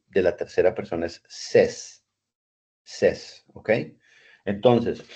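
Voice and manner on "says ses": says pronounced "ses", with a short e and no "ei"; teacher correcting how says sounds. Shown as a demonstration. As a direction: say says like "sez". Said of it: "la pronunciación ... es says".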